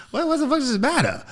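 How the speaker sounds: put-on squeaky high, loud childish protest; high-pitched bratty kid pleading. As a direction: whine back at dad like a small kid.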